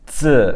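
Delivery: "zi" as loud dzuh with falling tone; fourth tone. Shown as a demonstration. Teacher breaking the word down, "zi" in falling tone. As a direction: drop "zi" sharply in the fourth tone. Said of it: "in the fourth tone".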